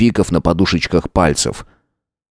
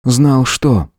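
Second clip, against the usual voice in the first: Howard's voice; 6 semitones higher than usual. A narrator's reading. Howard answers the narrator.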